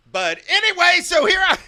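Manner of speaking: high, loud excited shout; sudden yell. Shown as a demonstration. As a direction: blast it out like you're hyped on mic. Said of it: "I can't help but to just yell".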